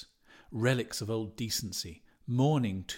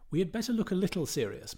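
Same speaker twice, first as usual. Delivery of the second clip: hurried aside; 7 semitones higher, faster than usual. Acted. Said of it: "Martin Cunningham said".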